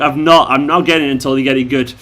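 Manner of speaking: ordinary talk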